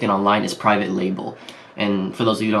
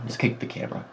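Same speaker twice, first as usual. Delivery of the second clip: whispered aside; 10 dB quieter than usual. Real, not acted. Aside after kicking camera.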